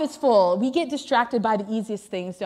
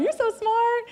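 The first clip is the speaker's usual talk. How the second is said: smug self-praise; 9 semitones higher than usual. Retold or imitated. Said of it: "And I was like".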